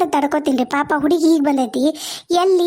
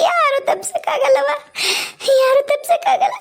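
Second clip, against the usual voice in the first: higher, voice straining with grief; tearful wail; 8 semitones higher than usual. Acted. Mother to daughter at the parting, wailing in tears. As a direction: cry out that fate cannot be escaped.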